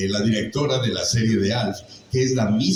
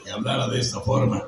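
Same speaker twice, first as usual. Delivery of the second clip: hoarse, airy rasp, fast; raspy voice demo; faster than usual. Shown as a demonstration. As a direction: show the boozy rasp quickly. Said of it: "una aguardentosa".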